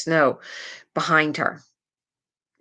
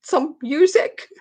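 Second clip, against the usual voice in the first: mock tearful little girl; imitated crying; 11 semitones higher than usual. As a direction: whine it through pretend tears. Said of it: "She's just crying".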